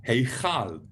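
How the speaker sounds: Heichal with a rasping ch like Scottish loch; Heichal, stress on hei, ch scraped in the throat; throaty ch in Heichal. Shown as a demonstration. Teacher models Heichal, throaty ch. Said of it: "the K with the hat on top".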